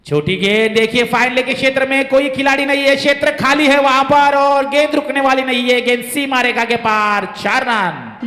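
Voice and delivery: rising excitement as the ball races away; excited cricket commentary. Real, not acted. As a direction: build up loud and high to the boundary. Boundary hit, commentator rises.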